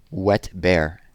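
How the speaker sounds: ordinary delivery, nothing marked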